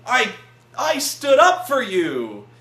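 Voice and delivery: loud, slow, indignant cry of betrayal; betrayed outburst. Retold or imitated. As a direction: yell it at the screen like a fan who was let down. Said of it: "and I'm like".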